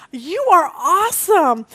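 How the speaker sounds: slow, loud, high-pitched admiration; emphatic praise. Retold or imitated. Telling the girl who carried books and cards, slow gushing praise. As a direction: draw out the praise with gusto.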